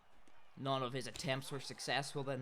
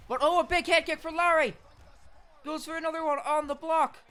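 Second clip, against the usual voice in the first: high, very loud, fast yell; excited shout; 14 semitones higher, 10 dB louder, faster than usual. Real, not acted. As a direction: scream it as the head kick lands. Excited fight commentary.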